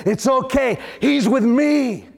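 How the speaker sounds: high and very slow, assured; calm triumphant. Retold or imitated. Christ telling the devil, calm, high and very slow. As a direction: savour each word like a victory.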